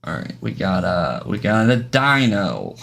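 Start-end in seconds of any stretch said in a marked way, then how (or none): 1.2-2.7 slow, stretched-out show-and-tell; drawn-out announcing. Pretend. Streamer presenting glyphs to viewers, in a playful slow drawl. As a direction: draw out each word as you present the item. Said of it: "I'm gonna do 'em all like this".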